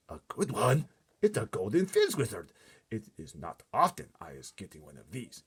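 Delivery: loud, high delight; excited giant. A narrator's reading. Giant boasts to Sophie about the dream, excited. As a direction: crow over the rare find.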